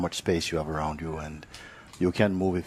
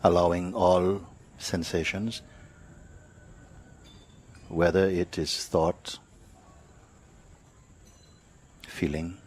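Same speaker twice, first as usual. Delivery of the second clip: guided meditation; 3 dB quieter than usual. Real, not acted. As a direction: lead them unhurriedly, phrase by phrase, into awareness.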